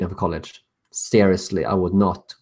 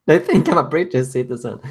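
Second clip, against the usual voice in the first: laughing; 4 semitones higher than usual. Real, not acted. Laughing at the doxxers' mistake.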